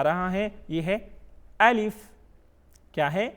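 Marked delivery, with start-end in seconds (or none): 1.6-1.9 الف said short, higher-ending with a clean final f; model pronunciation. Shown as a demonstration. Teacher shows pupils the letter, الف short with final f.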